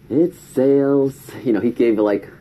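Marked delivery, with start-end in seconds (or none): 0.1-1.2 slow, haughty it's sales; aloof drawl. Retold or imitated. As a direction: drag it out, too cool to care. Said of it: "the tonality of how he says it".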